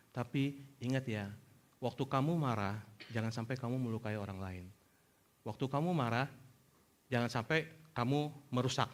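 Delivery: low, very soft, patient voice; gentle fatherly counsel. Retold or imitated. Father allowing son's anger but setting limits, soft and calm. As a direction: quietly soothe and guide an angry child.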